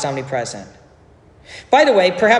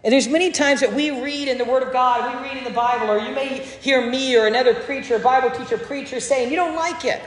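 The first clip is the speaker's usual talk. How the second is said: raised pulpit voice, higher; preaching at full force; 6 semitones higher than usual. Acted. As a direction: preach it out to the congregation.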